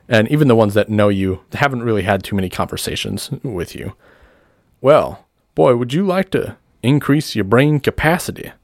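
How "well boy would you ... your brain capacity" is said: higher-pitched huckster sales question; showman's offer. Acted. Doctor Paul offering the boy brain capacity, showman's pitch. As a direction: dangle the offer before the crowd.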